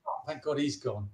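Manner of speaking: low, very soft retold sigh of relief; hushed relief. Pub manager once the drunk actor left, quietly relieved. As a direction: imitate a relieved boss under the breath.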